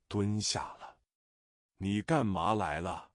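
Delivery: ordinary delivery, nothing marked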